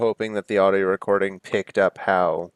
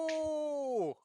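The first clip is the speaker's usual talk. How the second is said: startled oh; 11 dB quieter than usual. Acted. Landing after ramp jump.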